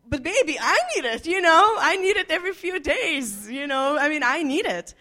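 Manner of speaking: pleading partner. Acted. Husband begging his wife for what he needs, pleading. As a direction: plead like a needy spouse.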